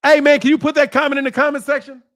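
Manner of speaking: ordinary talk, nothing marked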